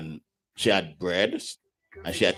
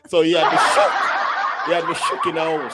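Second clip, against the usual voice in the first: high, loud words through laughter; laughing; 7 semitones higher, 6 dB louder than usual. Real, not acted. Guest admitting he was shook, laughing through it. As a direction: barely get it out for laughing. Still laughing over the fight.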